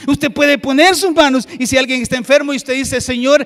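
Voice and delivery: very loud, high, rousing sermon; full-force preaching. Real, not acted. Preacher urging listeners to pray for the sick themselves, full-force preaching. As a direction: proclaim it to the whole congregation.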